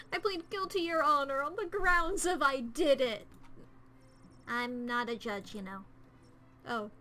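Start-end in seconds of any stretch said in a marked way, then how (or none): 0.1-3.3 high, loud, slowing theatrical plea; mock courtroom plea. Confessing guilt to the group as if in court, hammed-up. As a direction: play it like a dramatic trial.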